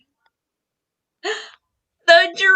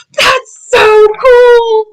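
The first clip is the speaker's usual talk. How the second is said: amazed; 5 semitones higher, 6 dB louder, slower than usual. Real, not acted. Gasp of delight.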